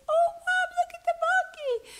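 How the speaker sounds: put-on high, excited child calling his mom; little boy's voice. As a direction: squeal it like a small boy spotting a monkey. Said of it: "he looks up and goes".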